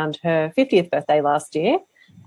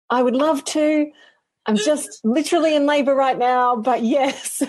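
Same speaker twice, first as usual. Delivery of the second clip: quoting a friend; 8 semitones higher than usual. Retold or imitated. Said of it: "she was just like".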